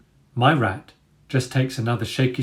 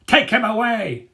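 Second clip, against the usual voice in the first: barked order; 11 semitones higher than usual. A narrator's reading. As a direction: bark it out. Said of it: "Dagger barks".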